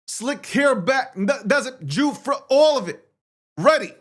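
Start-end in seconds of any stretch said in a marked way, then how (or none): 3.6-3.9 louder, rising hype call; pumped up. Real, not acted. Streamer hyping himself to viewers, pumped up. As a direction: psych yourself up and fire it out.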